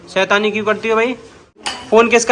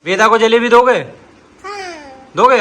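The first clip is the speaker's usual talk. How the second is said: louder, insistent repeat of the question; 4 semitones higher, 4 dB louder than usual. Real, not acted. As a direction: press the question harder. Asking again, louder. (ज़ोर देकर दोबारा पूछना)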